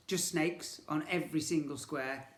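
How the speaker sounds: ordinary delivery, nothing marked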